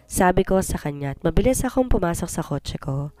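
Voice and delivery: usual speaking voice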